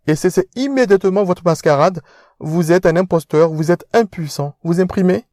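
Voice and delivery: pompous aristocrat lecturing; posh fiancé scolding. Acted. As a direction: talk down to him.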